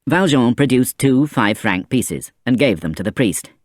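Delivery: usual speaking voice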